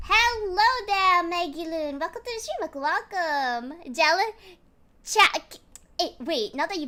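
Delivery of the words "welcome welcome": plain reading voice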